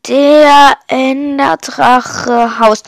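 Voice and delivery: very slow, stretched-out words; drawn-out note-taking voice; noting it down. Acted. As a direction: say it slowly as if writing each word. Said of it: "notiert er sich".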